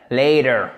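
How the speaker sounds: "Later" with the T softened almost to a D; American flapped T. Shown as a demonstration. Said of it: "Le T devient presque un D".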